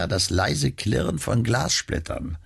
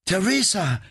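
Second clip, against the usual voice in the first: sobbing cry; 12 semitones higher than usual. Acted. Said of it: "rief Nora schluchzend".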